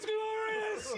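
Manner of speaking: very high imitation of an awestruck cry; hammed-up cry through laughter; mock exultant shout. Game master teasing the player's shout, mock exultant. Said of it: "to shout that at a magical phenomenon".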